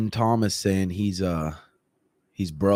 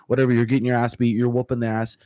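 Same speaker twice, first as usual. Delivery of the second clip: usual speaking voice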